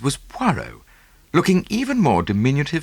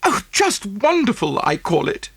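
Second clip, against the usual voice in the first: high, gushing society woman's voice; the voice of a pleased rich wife; gushing rich lady; 10 semitones higher than usual. A narrator's reading. Mrs Oppelson gushing over her pearls, high and pleased. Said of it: "said Missus Oppelson".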